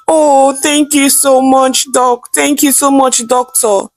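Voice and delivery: high, slow, overjoyed thanks to the doctor; excited. A narrator's reading. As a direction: gush with relief and joy, pitched high. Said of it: "sounding very excited".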